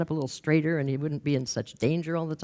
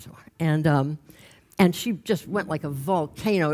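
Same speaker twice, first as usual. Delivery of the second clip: ordinary delivery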